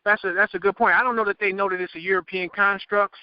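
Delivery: normal talking voice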